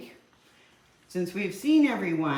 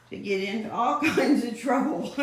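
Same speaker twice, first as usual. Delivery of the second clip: laughing; 8 semitones higher, 5 dB louder than usual. Real, not acted. Laughing about cats' mischief.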